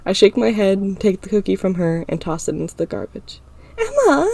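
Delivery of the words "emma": high, shocked cry; protesting friend. A narrator's reading. Ira as her cookie hits the bin, high and shocked. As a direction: yelp her name in protest.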